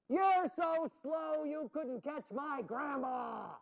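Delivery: high, drawn-out slow taunt. Acted. Jack calling down the tunnel to provoke the dragon, taunting. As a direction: stretch every word to mock the dragon.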